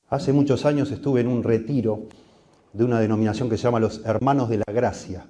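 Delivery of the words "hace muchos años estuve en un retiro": ordinary delivery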